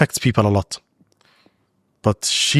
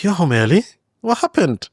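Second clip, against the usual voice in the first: his aunt's voice, much higher, concerned; imitating his aunt; 9 semitones higher than usual. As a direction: voice the aunt asking with a rising, worried pitch. Aunt voiced in retold story.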